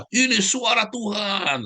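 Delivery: high, slow grandiose proclamation; lofty mock royal announcement. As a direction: drag it out like a self-made god. Herodes proclaims himself god.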